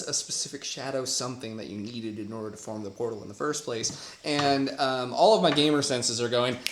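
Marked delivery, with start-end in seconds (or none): none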